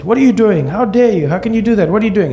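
high-pitched, indignant tirade; outraged mother. Retold or imitated. Mother getting up to confront husband, outraged.

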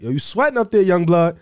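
high, mocking call of an older coworker; teasing boss. Boss teasing him on the job.

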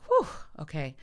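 loud exhaled mock relief; retold relief. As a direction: blow out the tension, then settle. Relief at catching train.

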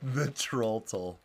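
very slow ominous trailer voice; movie trailer voice. Pretend. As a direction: intone the title slowly. Mock movie title announcement.

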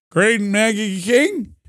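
put-on high, slow, drawn-out old man; hammed-up doddering old-timer; Joe Biden. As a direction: puzzle it out like a drunk old president. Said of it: "That's my Joe Biden impression".

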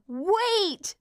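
high-pitched, desperate mock yell; imitated yell. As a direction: cry out as the conductor, pitch leaping up. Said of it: "The conductor yells".

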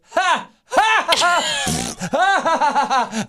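high, slow, hammed-up forced laughter; fake laugh to play it off. Playing it off in front of his date, hammed-up fake laugh. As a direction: cover the moment with a big phony laugh. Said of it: "I chose play it off like it didn't even happen".

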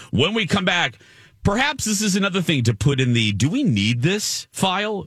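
upbeat radio segue into the break; radio host tease. Acted. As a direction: toss to the break like a DJ.

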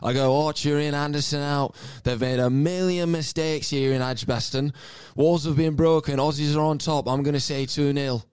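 loud, high, slow English commentator voice as Bumble; Bumble impression. Shown as a demonstration. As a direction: belt it out like a northern English cricket commentator. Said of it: "just give me your Bumble".

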